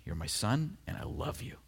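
soft, near-whispered love; hushed loving father; tender father. Acted. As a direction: say it gently, close and quiet. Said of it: "two statements about Jesus".

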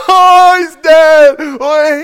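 very loud, high wail; hammed-up sobbing at a sad film; mock bawling. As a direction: bawl it out, drawn out and slow. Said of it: "So I'm bawling at this part".

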